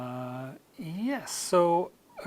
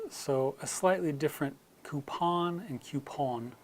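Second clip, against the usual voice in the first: usual speaking voice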